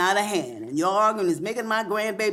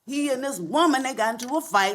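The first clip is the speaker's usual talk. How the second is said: usual speaking voice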